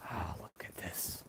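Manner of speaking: soft, voiceless whisper of discovery. Real, not acted. Vlogger whispering his find to viewers, hushed. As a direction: breathe it out as if not to be caught. Sneaking near someone's house.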